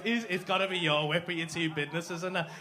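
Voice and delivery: ordinary delivery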